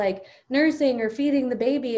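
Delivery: ordinary talk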